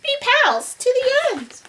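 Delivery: high, squeaky-sweet little mouse; peeping mouse. Acted. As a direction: peep a friendly offer to the lion. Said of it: "the mouse peeped".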